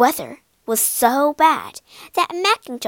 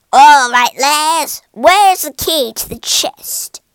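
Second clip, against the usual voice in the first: gruff pirate captain; 3 semitones higher, 10 dB louder than usual. Acted. Pirate captain demands key.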